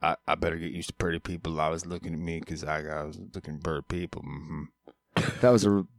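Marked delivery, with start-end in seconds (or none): 0.0-4.6 soft, muffled backwoods character voice; Carl Childers. Acted. Host quotes a movie he can't name, soft mumbled impression. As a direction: mumble it low and soft, ending on a grunt. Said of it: "I'm trying to do".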